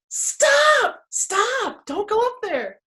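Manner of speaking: high, urgent acted shout; calling out a warning. Narrator calling Rory back from the stairs, urgent shout. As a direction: cry out high to halt someone.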